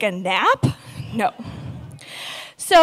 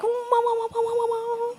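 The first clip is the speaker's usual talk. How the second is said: much higher, softer, wobbling "wah, wah"; warbly wah sound; 9 semitones higher, 7 dB quieter than usual. Retold or imitated. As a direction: mimic a drugged, wavering hum in the ears. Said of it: "the sound's like".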